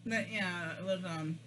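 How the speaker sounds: talking around food; chewing while talking. Real, not acted. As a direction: mouth partly full. Seen 3: Bites food at -7; cheeks bulge, jaw working while speaking.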